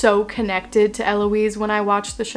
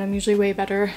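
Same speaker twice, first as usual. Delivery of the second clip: ordinary delivery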